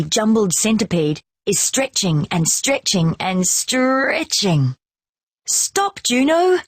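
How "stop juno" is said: high call from a distance. A narrator's reading. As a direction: call out to stop the dog. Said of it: "we hear someone call".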